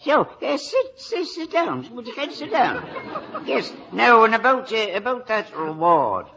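high, stammering eagerness; sudden eager fluster. Acted. Sergeant hears of the reward, eager and high.

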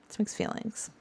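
whispered to yourself; whispered echo. Shown as a demonstration. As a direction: whisper it back, almost silent.

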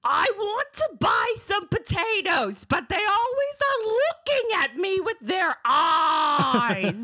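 high, slow, shrill-free squawking rant; Gilbert Gottfried. Acted. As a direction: crank it up high and slow like a screeching comic. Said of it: "it's time for your Gilbert Gottfried".

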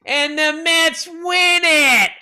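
high, loud imitation announcer with drawn-out words; sportscaster's call. Imitating the game call that always got him, excited announcer shout. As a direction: mimic an excited play-by-play call, stretching the win. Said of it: "that was the call that always got".

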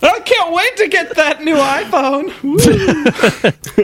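high, slow, laughing fake cheer; mock excitement. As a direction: ham up the excitement. Sarcastic fake excitement about iPhone.

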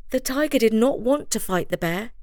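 ordinary delivery

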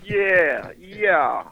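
drawn-out, falling Jamaican "yeah"; mock Jamaican accent. Shown as a demonstration. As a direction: show off a comic Jamaican "yeah". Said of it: "If you really want to speak Jamaican".

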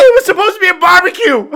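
high, fast through laughter; laughing. Pretend. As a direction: squeal it while laughing. Laughing joke.

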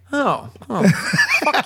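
mock dismay, rising high; Bruce Lee. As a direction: imitate a dismayed young man, half laughing. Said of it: "young Bruce Lee is like".

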